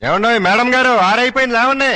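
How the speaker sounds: high-pitched holler; crowing wake-up call. Acted. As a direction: holler like a rooster at dawn. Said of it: "కోడి పుంజులా అలా కుయ్యక్కర్లేదు".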